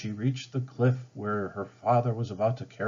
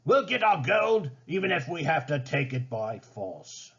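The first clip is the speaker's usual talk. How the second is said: raging; 6 semitones higher than usual. A narrator's reading. Ratcliffe vowing to seize gold, angry. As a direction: snarl the threat at his men. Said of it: "Ratcliffe raged".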